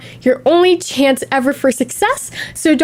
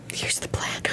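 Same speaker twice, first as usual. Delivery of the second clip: secretive whisper; 17 dB quieter than usual. Acted. Secretive plan announcement.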